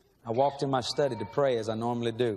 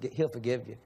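ordinary delivery

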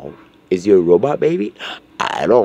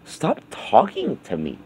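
ordinary talk

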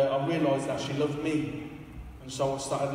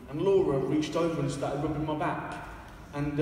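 ordinary talk

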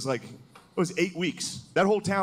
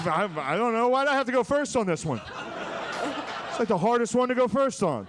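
defensive congressman; 8 semitones higher than usual. Acted. Mock congressman protesting his turn.

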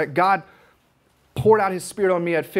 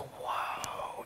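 breathless whispered whoa; hushed unvoiced whoa; awed whisper; 16 dB quieter than usual. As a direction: whisper in amazement.

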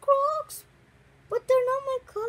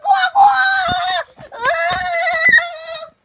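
playful yell; 6 semitones higher, 7 dB louder, much slower than usual. Pretend. Toy character yells playfully.

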